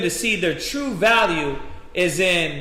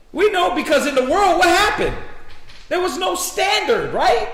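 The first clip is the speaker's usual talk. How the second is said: preaching; 6 semitones higher than usual. Acted. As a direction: push the sermon hard at the men. Preaching at full force.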